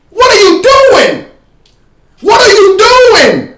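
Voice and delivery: high, loud, slow disbelieving yell; stretched-out furious what are you doing; exasperated shout. Real, not acted. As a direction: yell at the defender on the screen in disbelief. Coach ranting at film.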